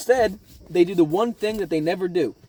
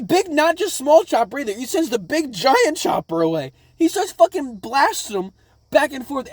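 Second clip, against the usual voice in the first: excited; 10 semitones higher, 4 dB louder than usual. Real, not acted. Excited recap of crew vanishing.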